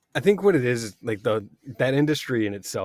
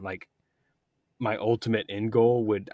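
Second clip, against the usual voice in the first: ordinary delivery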